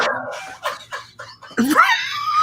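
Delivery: howling, high-pitched laugh; loud burst of laughter. Real, not acted. Host cracking up at the interview clip, howling laugh.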